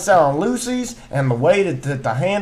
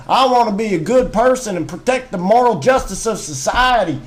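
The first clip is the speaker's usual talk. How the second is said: thick Southern twang; 2 semitones higher than usual. Acted. Ranting about police to a friend, thick Southern twang. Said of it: "Your twang's getting thicker".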